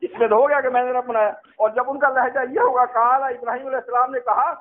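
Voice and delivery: ordinary talk, nothing marked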